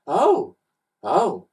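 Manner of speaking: bright, loud eager exclamations; a touch of mock enthusiasm; eager agreement. A narrator's reading. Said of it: "agreed Ned".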